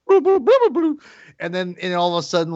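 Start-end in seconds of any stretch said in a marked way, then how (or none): 0.1-1.0 high, loud droid chirps; imitating BB-8 beeps. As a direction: beep like a little round droid, way up high. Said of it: "B B eight will go".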